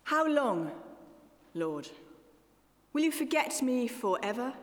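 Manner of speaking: solemn Bible reading to a congregation; scripture reading. Acted. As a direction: read the lament with aching gravity. Reads Psalm thirteen aloud.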